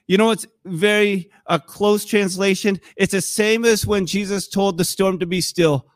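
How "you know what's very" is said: normal talking voice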